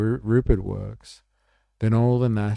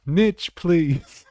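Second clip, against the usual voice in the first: laughing tease; 9 semitones higher, 8 dB louder than usual. Real, not acted. Teasing the guest with the word.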